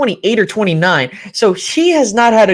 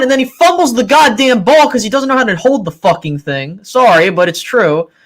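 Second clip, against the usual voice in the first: ordinary delivery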